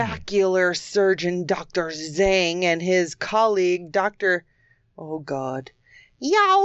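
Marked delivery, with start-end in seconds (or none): 5.0-6.6 sudden ninja-like shriek on Yao; death cry. Real, not acted. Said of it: "That's a death cry of a ninja".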